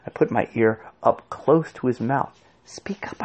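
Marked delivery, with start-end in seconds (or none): 2.7-3.2 very soft whispered urging; barely audible, breath only. A narrator's reading. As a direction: whisper it right into his ear. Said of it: "sinking my own voice to a whisper".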